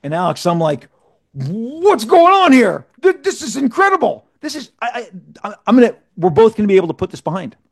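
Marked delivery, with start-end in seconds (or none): 1.3-7.6 acting out his own shock; pitched-up flustered stammer; stunned disbelief. Retold stunned reaction.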